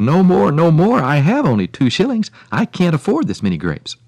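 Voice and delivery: high, loud, urgent protest; crying out. A narrator's reading. Poor woman stopping the lady's generous snipping, crying out. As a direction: cry out in alarm, easing slightly. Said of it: "the woman cried".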